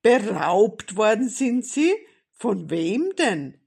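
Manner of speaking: loud, incredulous questioning; astonished. A narrator's reading. Policeman asking Grandmother who robbed her, astonished. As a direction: demand it loudly in amazement.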